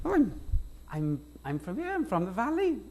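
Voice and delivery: put-on high, lilting Welsh Valleys accent; imitating a learner's Welsh Valleys accent; attempted Valleys accent. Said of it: "stay in accent".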